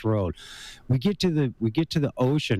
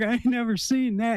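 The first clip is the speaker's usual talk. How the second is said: hillbilly; 10 semitones higher than usual. Retold or imitated. Hillbilly's scared reply retold.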